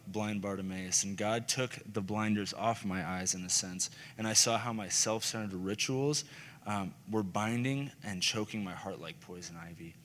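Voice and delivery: usual speaking voice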